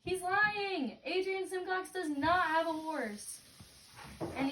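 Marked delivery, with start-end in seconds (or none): 0.0-3.4 retold yell. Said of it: "So I yelled from the monkey bars".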